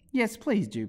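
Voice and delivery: high, eager little plea; entreating Alice; pleading. A narrator's reading. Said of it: "pleaded Alice".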